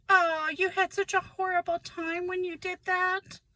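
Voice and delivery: high, sing-song fake pity; mocking fake sympathy. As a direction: imitate relatives pretending to feel sorry. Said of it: "Literally in that voice".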